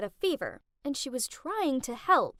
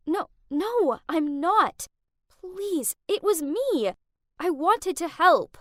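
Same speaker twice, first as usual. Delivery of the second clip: pleading; 5 semitones higher, 6 dB louder, slower than usual. Acted. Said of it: "I pleaded".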